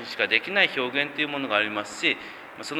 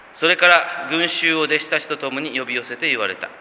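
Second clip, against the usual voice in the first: scripture reading; 3 semitones higher, 4 dB louder than usual. Acted. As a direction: read the Gospel aloud with solemn weight. Preacher reads scripture aloud.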